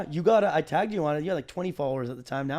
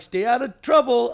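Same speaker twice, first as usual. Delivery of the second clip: higher, louder, slow mock-parental warning; higher, louder, slow cameo advice to a fan's child; mock fatherly advice; 7 semitones higher, 8 dB louder, slower than usual. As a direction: lecture the kid like a hammed-up dad. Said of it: "and I'm like".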